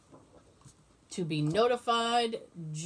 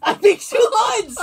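loud, high laughing exclamation; 13 semitones higher, 9 dB louder than usual. Real, not acted. Reacting to the two-lines joke, loud laughing. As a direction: crack up at the idea.

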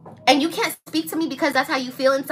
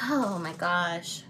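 exasperated; 7 semitones lower, 8 dB quieter, much slower than usual. Real, not acted. Exasperated aside.

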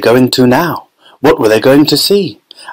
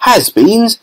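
high baffled question; puzzled cry; 10 semitones higher than usual. A narrator's reading. Violet reading the storeroom door, puzzled cry. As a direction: toss the word back in disbelief. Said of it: "cried Violet Beauregarde".

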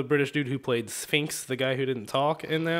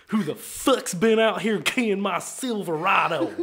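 raised, drawling Texan rant; angry drunk Texan; 4 semitones higher, 4 dB louder than usual. Acted. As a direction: rage in a hokey Texan accent. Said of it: "Angry, drunk Texan".

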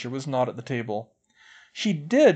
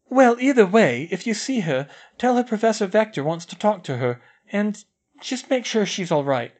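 concerned; 8 semitones higher, 6 dB louder than usual. A narrator's reading. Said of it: "sounding concerned".

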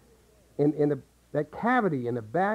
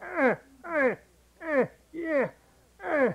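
much higher pinched grunts; strained grunting; 9 semitones higher, slower than usual. Shown as a demonstration. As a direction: squeeze out grunting uh sounds. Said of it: "if you're getting a grunting sound".

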